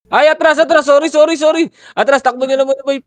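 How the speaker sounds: high, loud burst of alarm; sudden high-pitched yell to teammates; urgent retreat call. Real, not acted. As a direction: shout the squad back, high and frantic.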